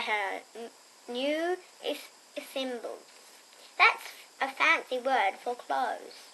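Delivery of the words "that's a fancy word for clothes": ordinary delivery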